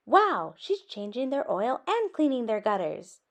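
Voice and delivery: plain reading voice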